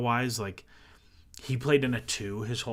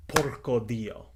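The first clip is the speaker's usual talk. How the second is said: Italian curse; slower than usual. Retold or imitated. Exhausted Italian climber sees the rope, slow Italian curse. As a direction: drag it out like an Italian swearing in dismay. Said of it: "in Italian".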